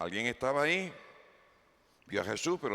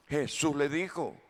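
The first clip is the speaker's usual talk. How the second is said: ordinary delivery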